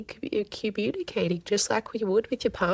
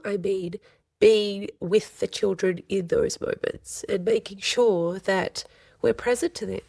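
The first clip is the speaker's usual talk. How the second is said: ordinary talk, nothing marked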